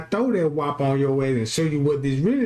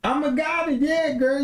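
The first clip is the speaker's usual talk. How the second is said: higher, sassy woman's voice; mock proud woman; 9 semitones higher than usual. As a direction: preen like a flattered woman.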